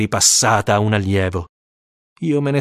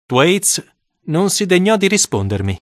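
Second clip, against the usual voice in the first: plain reading voice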